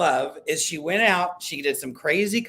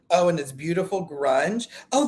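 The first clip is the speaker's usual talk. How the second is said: normal talking voice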